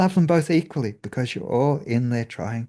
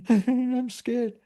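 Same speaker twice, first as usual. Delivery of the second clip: high squeaky mock fright; tiny hammed-up scared voice; 11 semitones higher than usual. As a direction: squeak it like a frightened little self. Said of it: "laugh at it / scared self".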